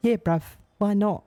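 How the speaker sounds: put-on Cockney accent. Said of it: "[name] does not have a Cockney accent".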